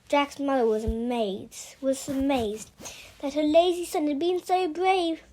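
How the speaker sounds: ordinary delivery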